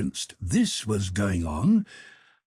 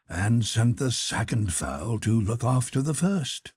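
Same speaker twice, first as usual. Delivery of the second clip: usual speaking voice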